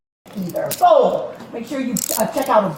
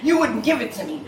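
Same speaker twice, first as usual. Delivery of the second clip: usual speaking voice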